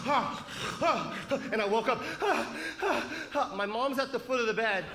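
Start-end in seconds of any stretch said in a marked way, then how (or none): 0.0-1.1 sharp mock choking gasp; gasping awake. Comic retelling his sleep to a crowd, gasping. 2.2-3.5 sharp mock choking gasp; gasping awake. Comic retelling his sleep to a crowd, gasping.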